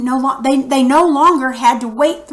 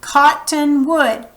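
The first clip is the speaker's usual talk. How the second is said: Cottonwood slowly, as three syllables COT-TON-WOOD; 4 semitones higher, slower than usual. Shown as a demonstration. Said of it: "They've got three syllables".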